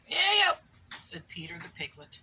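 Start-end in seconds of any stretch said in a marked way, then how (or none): 0.1-0.5 high, loud squeal of disgust. Acted. Reading to a child, milk all over the piglet, squealing.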